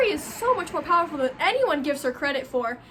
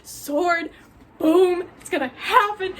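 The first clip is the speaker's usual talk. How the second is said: thrilled fan excitement; 2 semitones higher, 4 dB louder, slower than usual. Retold or imitated. Fan reliving her hope for the couple, gleeful and loud. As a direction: blurt it out like your dream scene is finally here. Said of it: "you can hear it in my voice".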